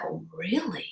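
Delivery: breathy whisper, nearly silent; whispered reaction. Retold or imitated. Retelling her reaction to a friend's old weekends, whispered. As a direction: whisper it as if shocked and sceptical. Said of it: "And I'm like".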